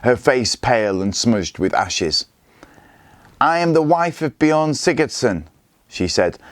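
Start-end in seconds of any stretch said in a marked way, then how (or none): 3.4-5.4 proud and higher, head held up; defiant. A narrator's reading. The widow defying the warlord, proudly higher. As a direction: answer him with defiance. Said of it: "stared back at Scully defiantly".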